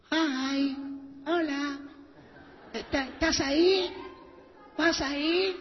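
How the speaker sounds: high, put-on coy voice; flirty texter. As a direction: coo each greeting in a high voice.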